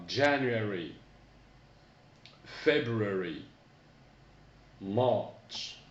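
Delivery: January, February, March spoken clearly, falling; careful month names; teacher modelling for repetition; model English pronunciation. Shown as a demonstration. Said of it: "Répétez après moi".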